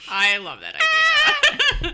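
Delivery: very high, loud, drawn-out squeal; thrilled, slow and shrieky with laughter; delighted. Real, not acted. Delighted at episode suggestion.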